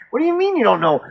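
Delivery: high-pitched, disbelieving demand; exasperated mock questioning; retold incredulity. Acts out his incredulous questioning of her.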